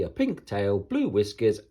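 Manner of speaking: ordinary delivery, nothing marked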